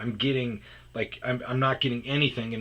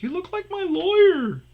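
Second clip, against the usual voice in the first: loud, very high comic falsetto; mock a stranger calling out in falsetto; exaggerated high-voiced recognition; imitated gawking stranger; 10 dB louder than usual. Imitates strangers approaching at gym.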